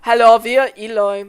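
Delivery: loud mock German greeting; German accent. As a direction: say hello in a put-on German accent. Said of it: "German be like".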